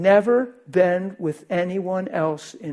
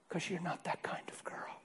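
soft intimate whisper; tender whisper; 3 semitones higher, 15 dB quieter than usual. Retold or imitated. Pastor holding her chin, tender whisper. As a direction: hush it like a secret blessing.

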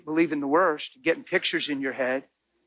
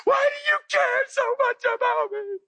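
screaming woman. Retold or imitated. Overwhelmed woman screaming at the pastor, high and frantic. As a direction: shriek it out, overwhelmed. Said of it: "screaming, going".

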